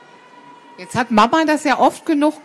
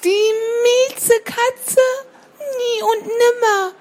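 put-on high, slow child voice near tears; a little bird protesting with a cry; sparrow child crying out; 11 semitones higher than usual. Baby sparrow protests to its mother, high and tearful. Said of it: "rief das Spatzenkind dann immer".

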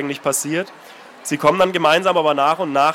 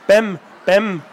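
lightly voiced three-year-old calling her brother; toddler calling; 3 semitones higher, 3 dB louder than usual. Little Anna on her mother's video, childlike call. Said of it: "gerufen hatte".

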